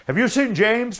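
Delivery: high, loud urgent question; a worried chase driver asking after his man; anxious asking. Retold or imitated. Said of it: "the guy that's on the coast side says".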